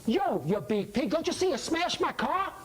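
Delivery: high-pitched, indignant street challenge; cocky, confrontational accusation; acted angry challenge. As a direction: pick a fight, pitch climbing to the end. Johnny yells at the big guy.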